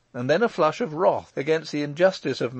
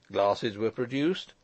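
usual speaking voice

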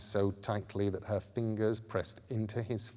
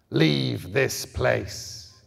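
very slow, louder ancient growl; guttural growl; 6 dB louder, much slower than usual. A narrator's reading. Indigo man ordering them out, slow growling menace. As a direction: drag every word out like a threat. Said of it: "words that were also a guttural growl".

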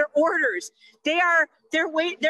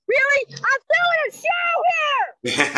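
high, slowed, indignant Hoffman voice; Dustin Hoffman impression; 12 semitones higher than usual. Mom doing Dustin Hoffman at a nurse during the show. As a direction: whine it like Ratso in Midnight Cowboy. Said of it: "Dustin Hoffman impression".

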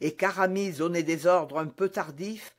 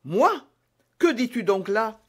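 emphatic, disbelieving questions; indignant exclamation; 3 semitones higher, 5 dB louder than usual. A narrator's reading. D'Artagnan answers a charge of poisoning, indignant. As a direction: protest in shocked disbelief. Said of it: "s'écria D'Artagnan".

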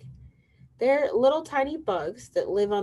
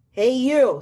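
loud, drawn-out challenging call; grouchy ladybug; 7 semitones higher, 10 dB louder than usual. Acted. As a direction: call out like a grouchy bug picking a fight. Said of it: "said the grouchy ladybug".

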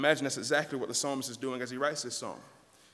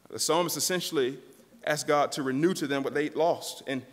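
usual speaking voice

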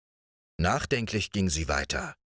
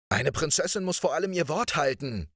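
prince calls out; 8 semitones higher than usual. A narrator's reading. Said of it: "rief er dagegen".

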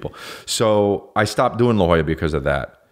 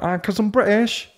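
very high, hammed-up British voice; silly high-pitched British impression; mock British accent; 14 semitones higher than usual. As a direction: mimic a British friend, pitched way up. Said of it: "he's actually that British".

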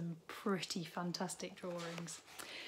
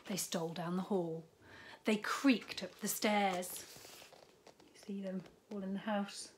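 hushed sneaky whisper; whispered storytelling; 3 dB quieter than usual. A narrator's reading. Reading to a child as the animals sneak in, whispered. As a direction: whisper as if creeping past the farmer.

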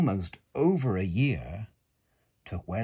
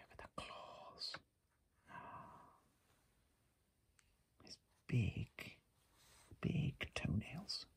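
whisper; 3 semitones higher, 15 dB quieter than usual. Real, not acted. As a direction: whisper it gently over the book.

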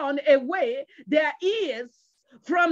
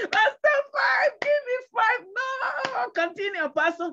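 hammed-up shout to the pastor; mimicked churchgoer; 10 semitones higher than usual. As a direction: imitate an over-eager flock, pitched way up. Mimics excited congregation member hailing pastor.

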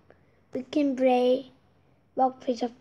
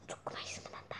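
whisper; 20 dB quieter, much faster than usual. Real, not acted. Whispered aside.

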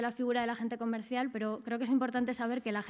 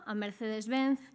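ordinary talk, nothing marked

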